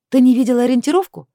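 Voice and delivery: loud, high-pitched incredulous question; indignant demand. A narrator's reading. Heroine challenging sleepy Chris on the phone, indignant. Angry phone confrontation continues.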